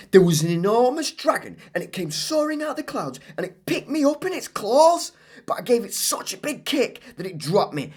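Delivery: put-on high, excited child telling a wild story; Claire's tall tale. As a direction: spin the tale breathlessly.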